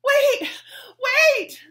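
loud high shout while running; panting call. Acted. Betsy chasing the girl down the hill, panting shout. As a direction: yell it out of breath from running. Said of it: "Betsy panted as she ran".

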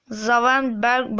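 ordinary delivery

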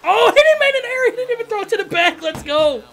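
can't believe what the fielder did; shocked squeal at the game; stunned disbelief. Real, not acted. Fielder botches the throw.

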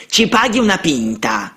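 put-on high, pushy boy's demand; boys demanding. A narrator's reading. Street boys surrounding the priest, high and demanding. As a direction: press him for the drink like a street kid. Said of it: "presero a gridare gli altri in coro".

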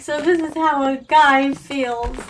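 a hammed-up awed reflection; dreamy wonder. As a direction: marvel at it slowly, like a revelation. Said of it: "I'm like".

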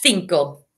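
"cinco" with the C as th, low and falling; Spain's cinco. Shown as a demonstration. Teacher choosing Spain's way for class, th sound in "cinco". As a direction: say "cinco" starting like English think. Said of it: "the country of Spain, we're going to say".

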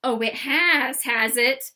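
drawn-out, defiant cry; loud, indignant retort; loud challenge. Acted. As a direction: throw the challenge back loudly. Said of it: "cried Pippi".

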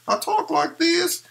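high ventriloquist figure voice; Lil Feller puppet voice. Shown as a demonstration. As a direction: pitch way up and speak as the puppet. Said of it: "Little feller does talk like this".

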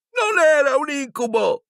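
put-on very high shouted boy's voice; yell. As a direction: shout it in protest. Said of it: "urlò Ron".

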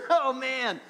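chuckling, high-pitched outburst; laughing. Retold or imitated. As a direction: laugh out an embarrassed reaction. Laughing reaction to being read.

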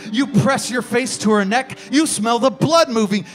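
ordinary talk

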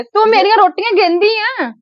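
high, outraged question; indignant disbelief. Acted. Aunt outraged that her rotis are counted, high indignant question. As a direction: act shocked that anyone would count her rotis.

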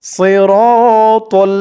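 সিরাতাল chanted slowly as heavy "siro-tol"; correct tajweed model. Shown as a demonstration. Teacher asking students to listen, সিরাতাল slow and heavy. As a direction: stretch the rounded "ro" in সিরাতাল, dark t. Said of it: "খেয়াল করে শুনুন".

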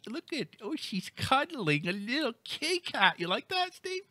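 high-pitched gushing over a kitten; teasing cooing. Pretend. Host teasing co-host with a little kitty cat, cooing.

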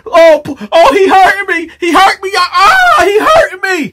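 high, wailing cries of a man in pain; crying out in pain. Retold or imitated. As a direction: whimper and wail as the cops grab him. Man being arrested, crying out.

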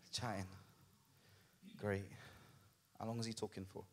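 very soft, low mock complaint; bored listener's barely audible aside; muttered grumbling. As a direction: grumble privately under your breath. Voices bored congregation's grumbling.

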